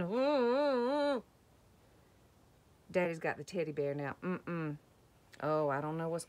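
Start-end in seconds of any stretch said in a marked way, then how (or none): 0.0-1.2 high, loud wavering "ooh"; doggy's wobbly ooh. Acted. Doggy hanging on as dinosaur stomps, high wobbly wail. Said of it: "He's going".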